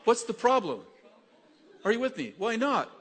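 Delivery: high, loud rousing preacher's call; rally the congregation; full-force preaching. Acted. Preaching climax.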